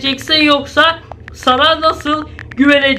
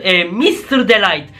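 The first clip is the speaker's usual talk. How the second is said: punning aside; 7 semitones lower than usual. Real, not acted. Pun on the name.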